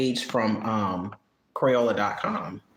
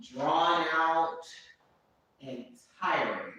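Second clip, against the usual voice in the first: exasperated venting; 3 semitones higher, slower than usual. Acted. Telling the viewers about a tiring week, slow exasperated drawl. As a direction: stretch every word like you are worn out.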